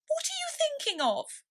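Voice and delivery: very high, sharp squeak; severe mouse. A narrator's reading. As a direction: snap the question shrilly. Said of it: "said the mouse to Alice severely".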